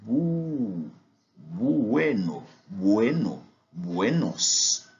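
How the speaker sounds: "bueno" built up syllable by syllable, u as ou; teacher builds up "buenos" step by step; Spanish u sound. Shown as a demonstration. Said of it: "U se prononce comme ou".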